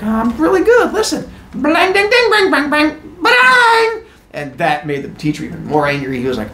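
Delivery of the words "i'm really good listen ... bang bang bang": proud bad lute player. Teen Heracles shows off lute.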